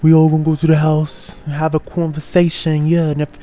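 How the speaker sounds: put-on Tavy's New York accent. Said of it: "that was such a bad New York accent".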